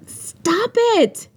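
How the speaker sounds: very high-pitched protest; high, playful-annoyed objection; arguing. A narrator's reading. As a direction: squeal it in protest. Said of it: "she argued".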